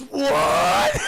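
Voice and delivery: loud, high shout of disbelief; mock outraged shout; shocked what. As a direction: yell it like the kid can't believe it. Ralphie shocked by Santa.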